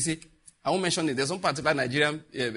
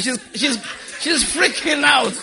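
laughing; 9 semitones higher, 5 dB louder, slower than usual. Real, not acted. Laughing about the panicking mother.

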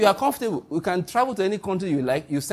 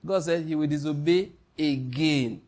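usual speaking voice

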